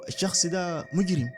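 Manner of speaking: ordinary delivery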